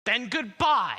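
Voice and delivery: high, loud furious farewell; angry shout. Acted. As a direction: shout the goodbye in anger. Quarrel, final farewell.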